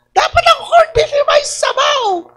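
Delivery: high, squeaky mocking voice of the spouse; mock his wife's insistence in a shrill-high whine; mimicking spouse. Mocking the spouse in the corned beef fight, high whiny voice.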